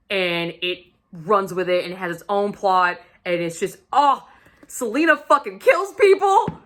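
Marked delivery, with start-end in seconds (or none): 3.9-6.6 high, loud gush; giddy fan squeal; excited outburst. Real, not acted. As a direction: burst out with thrilled disbelief. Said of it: "So excited".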